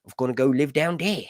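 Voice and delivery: mock English accent; lightly hammed-up English voice. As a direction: put on a British accent for the quote. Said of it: "the English people said".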